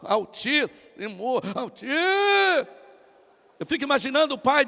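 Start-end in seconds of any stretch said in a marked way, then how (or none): 0.0-2.7 drawn-out, overdone address to God; put-on prayer voice. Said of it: "Tem outros que dizem". (invocação forçada, arrastada e solene)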